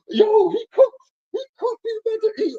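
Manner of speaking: very high falsetto squeal, mock hysterics; imitated freak-out. Antonio freaking out over the Nintendo tattoos, imitated squeal. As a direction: squeal it like a dude losing it. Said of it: "Antonio, is freaking out".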